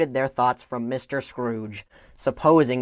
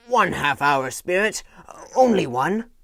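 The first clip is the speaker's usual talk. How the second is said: pleading Scrooge; 5 semitones higher, 3 dB louder than usual. Acted. Scrooge begs the spirit.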